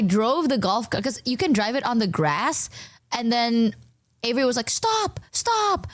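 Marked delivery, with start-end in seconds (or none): 4.7-5.9 high, urgent imitation of a friend; mock alarmed stop. Friend stopping her from driving on the good grass, high urgent. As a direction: pitch up to stop the cart.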